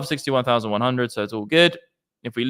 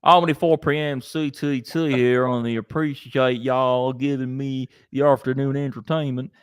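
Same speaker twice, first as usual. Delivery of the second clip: put-on southern accent. Reading a viewer's message to the stream, southern accent. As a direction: read it out like a Southerner. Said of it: "your accent went straight southern".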